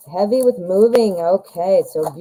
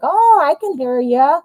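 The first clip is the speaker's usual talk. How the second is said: puppet voice; 7 semitones higher than usual. Retold or imitated. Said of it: "he's like".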